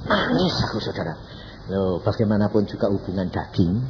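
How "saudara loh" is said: ordinary talk, nothing marked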